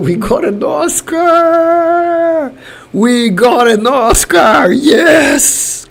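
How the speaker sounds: high, drawn-out victory cry; jubilant cheer. Real, not acted. Streamer announcing Brazil's win to viewers, jubilant. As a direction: stretch the words out slowly in real joy.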